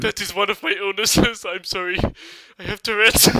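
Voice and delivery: flustered apology. Acted. Apologises after the outburst.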